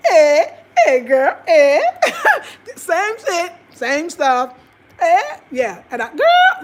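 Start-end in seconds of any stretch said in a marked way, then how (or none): 0.1-1.8 high, loud hooting "eh"; hooting Ghanaian sisters. Retold or imitated. Imitating family sisters hooting loudly with laughter. 1.8-2.7 loud squealing laugh; high burst of laughter. Real, not acted. Vlogger bursting into high laughter after her impression.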